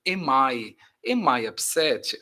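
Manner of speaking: "Am I upset?" run together, the m of am flowing into I; linked together. Shown as a demonstration. Teacher showing students how to link words. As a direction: glide "Am I upset?" as one linked chunk, slowly. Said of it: "você fala junto".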